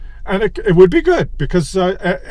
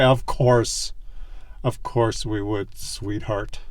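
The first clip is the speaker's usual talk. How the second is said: much lower and much softer, slow and tender; tender wife reassurance; 9 semitones lower, 8 dB quieter, slower than usual. Retold or imitated. Wife reassuring her husband they'd stay together, soft and tender. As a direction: murmur it lovingly, soothing him. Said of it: "she's gonna look at you and say".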